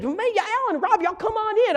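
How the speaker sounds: high-pitched, excited host imitation; excited welcome. Jerry calling two visitors into his house, excited. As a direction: act out an eager, high-voiced welcome. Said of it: "Jerry was so excited".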